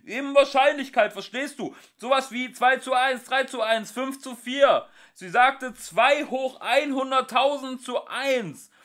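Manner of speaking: high, loud explaining shout. Acted. Ford shouting the odds to Arthur, loud. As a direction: bellow the numbers out.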